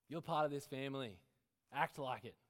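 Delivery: stern, loud scolding; stern parent. Acted. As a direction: snap it at the child. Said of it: "the mind frame of my past".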